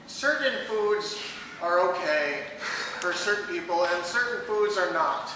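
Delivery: ordinary talk, nothing marked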